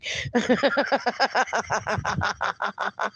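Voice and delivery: long rippling laughing fit; real laughter. Real, not acted. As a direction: keep giggling until it fades. Laughing along with Mark.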